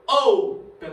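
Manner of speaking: "open" with a loud, drawn-out O; stress on penultimate syllable. Shown as a demonstration. Teacher showing students where the tonic syllable falls, stressed O in "open". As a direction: lean hard on the O of "open". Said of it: "É uma paroxítona".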